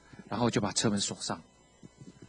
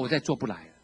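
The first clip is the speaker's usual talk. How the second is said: ordinary talk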